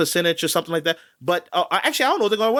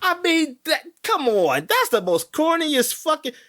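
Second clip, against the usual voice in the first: exasperated rant; 13 semitones higher than usual. Real, not acted. Ranting about cringe tweet.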